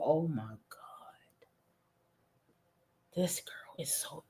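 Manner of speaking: stunned whispered aside. Real, not acted. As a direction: breathe it out in disbelief.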